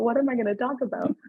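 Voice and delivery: normal talking voice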